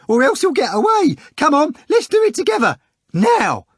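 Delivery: high, loud, jeering clown voice; clownish choir master. A narrator's reading. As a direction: goad him on in a mocking squeal. Choir master urges together.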